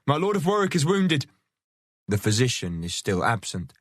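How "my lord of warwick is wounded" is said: high urgent announcement; crying out. Acted. Man-at-arms alerting the camp, urgent high cry. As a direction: call out the news, pitched up. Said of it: "he cries".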